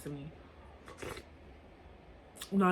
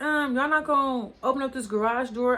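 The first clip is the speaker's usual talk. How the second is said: mild mock yell; 5 semitones higher, 3 dB louder than usual. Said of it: "I'm just screaming over there".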